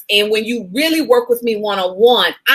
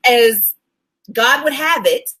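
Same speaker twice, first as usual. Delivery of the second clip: ordinary talk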